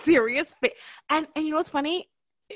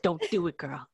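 calming ancestors; 5 semitones lower, 8 dB quieter than usual. Retold or imitated. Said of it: "all ancestors, like".

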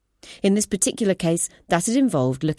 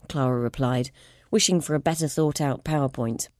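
ordinary delivery, nothing marked

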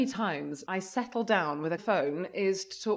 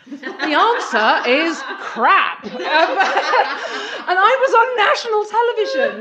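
words spilling out through loud laughter; laughing; 7 semitones higher, 9 dB louder than usual. Real, not acted. Cracking up as she recalls being on national television in that outfit. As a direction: laugh helplessly while confessing.

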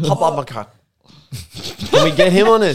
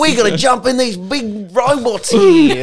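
put-on loud, slow, hammy Australian accent; bad Australian accent; 7 semitones higher, 5 dB louder, slower than usual. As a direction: overdo a cartoonish Australian accent. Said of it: "a really funny Australian accent".

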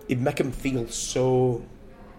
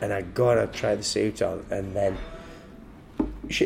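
usual speaking voice

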